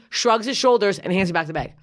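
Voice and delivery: usual speaking voice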